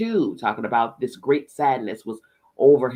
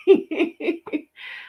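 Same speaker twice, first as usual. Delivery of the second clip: high excited laugh. Real, not acted. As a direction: giggle high in the voice. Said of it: "I am really excited".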